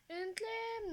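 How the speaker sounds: high, loud echo of a question; hammed-up character query; exaggerated questioning. Game character reacts to question.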